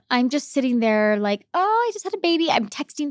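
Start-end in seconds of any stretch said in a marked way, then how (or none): 1.5-2.5 happy new mom. Retold or imitated. New mom glowing after birth.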